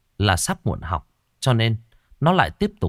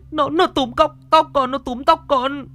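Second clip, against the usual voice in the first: high, wailing plea through sobs; crying in pain; 3 dB louder than usual. A narrator's reading. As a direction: beg through tears, hurting. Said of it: "nó vừa khóc vừa mếu máo".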